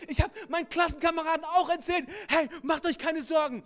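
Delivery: put-on child voice. Son answers his father.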